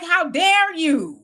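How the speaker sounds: slow, offended how dare you; drawn-out mock outrage at the question; indignant attitude. Mimicking her reaction to the interviewer, slow indignant outrage. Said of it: "That's kind of the attitude she had".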